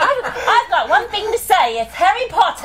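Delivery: high, loud hammed-up Cockney; Cockney accent. Shown as a demonstration. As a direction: belt it out in mock Cockney. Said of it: "great about a Cockney accent".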